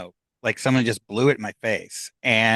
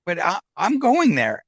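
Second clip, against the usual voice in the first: higher-pitched retold protest to an unseen dad; talking out loud to herself; 7 semitones higher than usual. Daughter telling dad's voice she's heading there, talking aloud to herself. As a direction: argue back into thin air, pitch jumping up. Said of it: "I'm talking out loud to myself".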